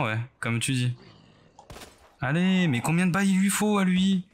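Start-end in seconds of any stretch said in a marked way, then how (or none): 2.2-4.3 high, loud exasperated outburst. Real, not acted. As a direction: throw up your hands in frustration. Zombie won't die.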